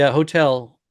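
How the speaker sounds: usual speaking voice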